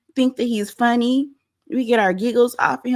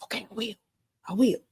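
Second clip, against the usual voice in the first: hushed reenactment; 9 dB quieter than usual. Retold or imitated. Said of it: "my imagination is".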